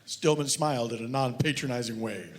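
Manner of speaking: deadpan reading of a mock detective novel; story reading. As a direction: read it dry, like a serious audiobook. Author reads his novel aloud.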